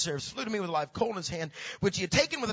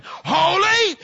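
high, full-force shout; long, loud, high holler; screaming angel; 11 semitones higher, 10 dB louder than usual. Acted. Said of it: "One angel's over here screaming".

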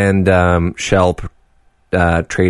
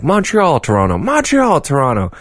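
high singsong back-and-forth; bickering quoted; 7 semitones higher than usual. Retold or imitated. Canadians squabbling over a capital, high singsong. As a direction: toss the city names like a squabble. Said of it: "it was like".

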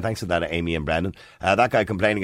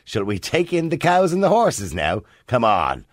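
sarcastic; 10 semitones higher, 4 dB louder, slower than usual. Retold or imitated. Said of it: "Somebody says".

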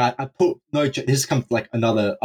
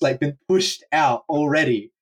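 plain reading voice

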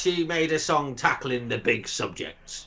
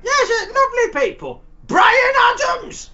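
excited shout; 5 dB louder than usual. Acted. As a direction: yell the name out with sudden glee. Sudden mock enthusiasm.

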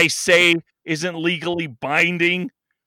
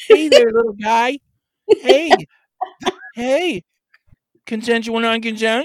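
put-on coaxing baby talk; 4 semitones higher, 6 dB louder than usual. Coaxing a pet-like creature to come out.

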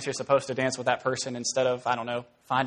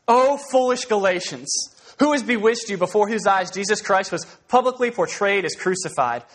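scripture reading; 2 semitones higher than usual. Acted. Preacher reading Paul's rebuke to the Galatians aloud. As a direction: proclaim the text.

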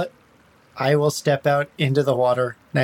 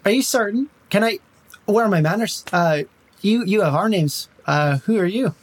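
light Irish lilt, charming and evasive; Irish accent. Acted. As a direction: talk the bandits round in a mild Irish brogue. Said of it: "Now you're making me Irish".

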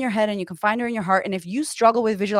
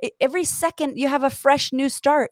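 ordinary talk, nothing marked

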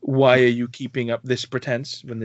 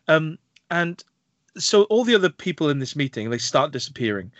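normal talking voice

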